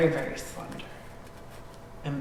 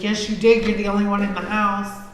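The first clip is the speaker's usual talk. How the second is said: loud, higher insistent accusation; retold accusing shout; 5 semitones higher, 7 dB louder than usual. She insists to Mike that he pushed her, loud accusing. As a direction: insist he did it.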